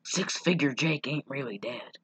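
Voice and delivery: hushed, low tip-off; quiet, low mock-conspirator voice; confidential undertone. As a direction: murmur it like a secret passed in a bar. Retold barfly confides a secret.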